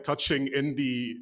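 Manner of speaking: ordinary talk, nothing marked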